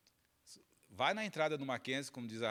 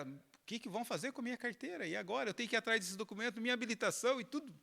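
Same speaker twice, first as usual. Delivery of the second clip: higher-pitched, fretting run of worries; retold desperation; 8 semitones higher than usual. Man thinking of his lost documents, retold desperation. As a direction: imitate someone spiralling into panic. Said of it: "o desespero que te dá".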